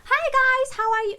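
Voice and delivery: mock high, loud vlogger hello; high-pitched greeting. Mocking own old video intros for viewers, very high-pitched. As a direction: shoot the pitch way up, loud and over-eager. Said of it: "very high-pitched tone".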